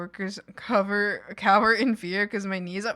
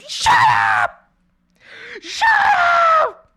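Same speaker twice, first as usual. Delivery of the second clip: mock shout; 7 dB louder than usual. Mock yelling at boss.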